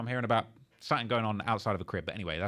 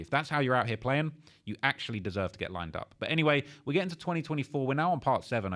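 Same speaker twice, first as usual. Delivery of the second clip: ordinary delivery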